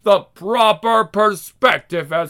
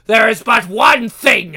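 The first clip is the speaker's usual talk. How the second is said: louder, stress on one; shouted retake; 5 semitones higher, 8 dB louder than usual. Acted. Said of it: "Excuse me".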